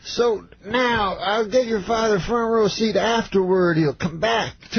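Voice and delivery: high, slow, expansive mimicry; Bill Cosby. Star offers opener's father front row seat, mimicked high and slow. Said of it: "He says".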